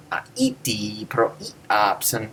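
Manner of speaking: slow Greek with the accent on the written syllable; "Aiti pro iapsen" slowly, old stress placement. Shown as a demonstration. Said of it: "No Greek would have said".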